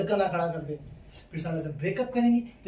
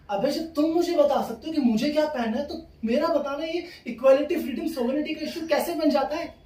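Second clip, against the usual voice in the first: high, worked-up comic rant; indignant protest; 7 semitones higher than usual. Acted. Boyfriend protests double standard about clothes, high indignant rant.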